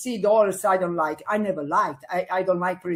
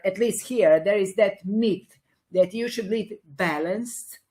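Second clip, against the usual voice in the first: ordinary talk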